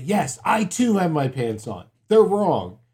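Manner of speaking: high, loud stubborn denial; mock-stubborn insistence; insisting loudly. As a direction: dig in and raise your voice. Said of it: "say it a little bit louder".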